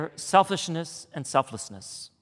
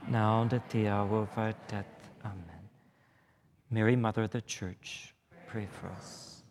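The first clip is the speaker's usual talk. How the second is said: low, unhurried prayer voice; 4 semitones lower, 4 dB quieter than usual. Acted. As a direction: lead the room in prayer, reverently. Leads the congregation in prayer.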